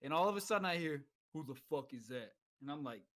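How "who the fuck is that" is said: low, soft challenge from the dark; roommate in the dark. Retold or imitated. Roommate challenging a stranger entering the dark barracks room, low and quiet. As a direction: imitate a roommate's low, subdued demand.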